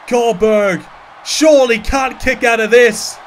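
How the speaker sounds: high, loud, frantic ringside call; wrestling commentator. Acted. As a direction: scream the big moves like a live announcer. Live wrestling play-by-play.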